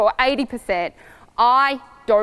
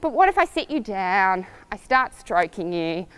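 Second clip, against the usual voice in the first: usual speaking voice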